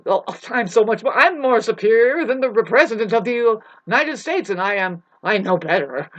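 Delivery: high, loud mocking of a smug know-it-all; a mocking imitation of a superior know-it-all. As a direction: sneer it like someone who thinks they know better. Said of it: "they're always stupid".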